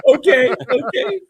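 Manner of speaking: high laughing okays. Real, not acted. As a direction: crack up while agreeing. Laughing at the bit.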